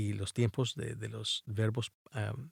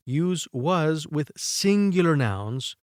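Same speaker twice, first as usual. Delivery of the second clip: very slow English reading; slow rule reading; 3 semitones higher, much slower than usual. Shown as a demonstration. Teacher reading a grammar rule to students, very slow. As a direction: read the rule slowly, word by word. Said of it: "Utiliza was con sustantivos singulares".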